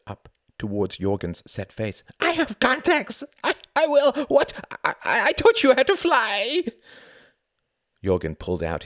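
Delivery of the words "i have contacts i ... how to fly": very high, loud, stammering shrieks; desperate screaming. A narrator's reading. The Count pleads with Jorgen for life, hysterical screaming. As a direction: scream and stumble over words begging for life. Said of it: "he screamed".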